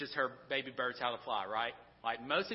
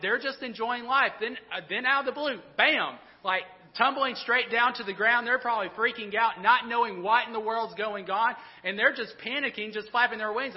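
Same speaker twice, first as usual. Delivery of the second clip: louder and higher, breathless storytelling of the fall; excited retelling; 5 semitones higher, 6 dB louder than usual. Retold or imitated. Preacher to congregation, picturing baby birds falling, excited and loud. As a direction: build the panic of the falling chicks.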